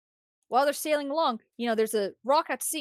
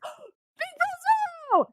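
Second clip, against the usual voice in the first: very high, drawn-out mock wail; hammed-up high cry of recognition; overjoyed cry; slower than usual. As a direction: call out to your long-lost hero, stretched and squealed. Joany cries out to Zoro.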